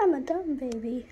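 normal talking voice

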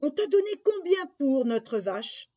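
high, loud motherly question; eager mother. Acted. Mother asks what the cow fetched, high and eager.

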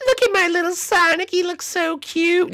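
cooing little character. Acted. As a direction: gush in a tiny high voice. Figure coos over little Sonic.

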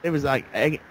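ordinary delivery

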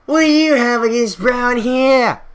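high, loud mock-Australian heckle; pseudo-Australian accent. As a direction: shout it out in a fake Australian accent. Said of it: "a pseudo-Australian accent this entire time".

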